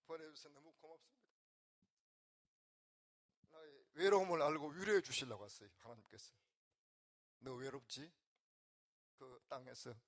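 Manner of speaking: whispered thanks through tears; tearful prayer. Retold or imitated. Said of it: "고향 땅에서 목사가 찾아왔어".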